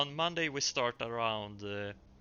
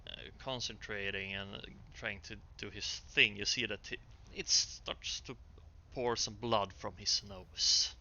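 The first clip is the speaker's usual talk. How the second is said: game master narration. Acted. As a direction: draw the players into the scene.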